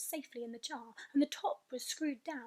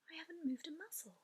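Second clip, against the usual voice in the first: whispered protest; 5 semitones higher, 11 dB quieter, faster than usual. Acted.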